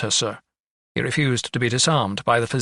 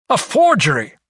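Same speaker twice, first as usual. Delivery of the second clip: louder, higher exclamation; outraged cry; 6 semitones higher, 6 dB louder than usual. A narrator's reading. Doctor discovers his forged signature, outraged cry. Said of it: "he cried".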